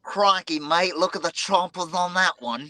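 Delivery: put-on broad Aussie accent, high and bright. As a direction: Steve Irwin style Australian accent. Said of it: "Give me your best Aussie accent".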